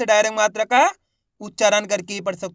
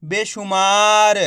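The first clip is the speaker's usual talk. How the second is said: बेशुमार drawn out slowly, every syllable clear; slow, patient teacher blending; slow blended reading; 3 dB louder, much slower than usual. Shown as a demonstration. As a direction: read the word slowly to a beginner. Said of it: "तो मिलके बन जाएगा".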